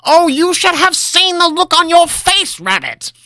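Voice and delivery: high, slow, cackling glee. Acted. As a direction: gloat through mad laughter. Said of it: "Linram cackled madly".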